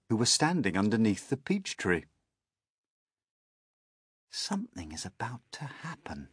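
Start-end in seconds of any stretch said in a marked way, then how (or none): 4.3-6.3 soft, slightly raised wondering voice; talking to himself. A narrator's reading. Boy sensing something coming, murmuring to himself. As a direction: murmur it with hushed anticipation. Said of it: "he told himself".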